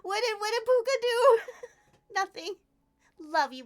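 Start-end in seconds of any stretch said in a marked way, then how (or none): 0.0-1.4 higher and louder, alarmed question; mock-alarmed what happened; retold alarm. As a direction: replay the moment of panic. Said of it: "I was like".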